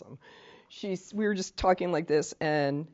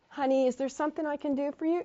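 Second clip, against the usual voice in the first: higher-pitched, lightly sugary helper; mock patronizing; 9 semitones higher than usual. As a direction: talk down to an elderly person, pitched up. Patronizing helper addressing elderly person.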